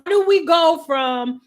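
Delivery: ordinary talk, nothing marked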